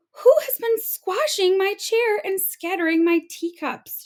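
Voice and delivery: high, outraged mother octopus; mother octopus finds her chair squashed; indignant mother octopus. Acted. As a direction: squeal it like a scandalised mum.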